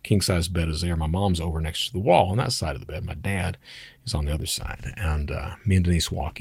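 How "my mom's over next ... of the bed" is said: normal talking voice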